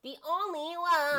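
high, loud nasal whine. Retold or imitated. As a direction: overdo the baby doll whine. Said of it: "the most nasally [name] can possibly be".

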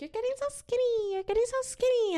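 very high squealed compliment; imitating gushy, high-pitched praise; gushing admirers. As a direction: squeal it like a fawning friend.

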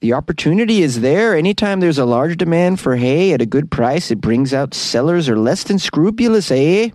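singsong mock Canadian accent; bouncy Canadian accent, each "eh" tossed upward. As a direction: quote him in a hammed-up Canadian lilt. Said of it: "What do you guys think of this Canadian accent?".

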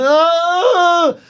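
high strained cry; straining groan. Retold or imitated. Patient straining on a potty, pained groan. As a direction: push and groan.

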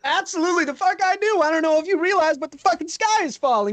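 high, rattled street-tough voice; high-pitched panicky rogue. Acted. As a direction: squeak it out, scared and defiant.

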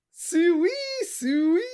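high-pitched mock pig call; hog call. Homer mocking a boy's photo, high hog call. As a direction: call the hogs in a high, rising voice. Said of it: "did the Suey call".

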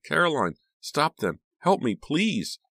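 high, desperate plea; high cry, stretching into a drawn-out plea. A narrator's reading. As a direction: call out pleadingly for help. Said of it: "she cried".

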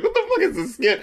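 high laughing outburst; laughing disbelief. Retold or imitated.

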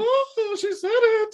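high, loud delighted squeal; excited squeal. Real, not acted. Shrieking laugh at the ad praise.

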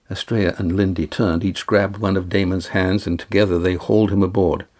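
ordinary delivery, nothing marked

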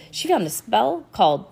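ordinary delivery, nothing marked

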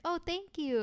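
high, polite gushing thanks; sweetly grateful. Retold or imitated. Bride thanking a congratulating stranger, high and sweet. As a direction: re-enact a pleased, polite reply.

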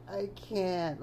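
high helpless whine; mock a girl who gives up; mock whiny girl. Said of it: "she's like".

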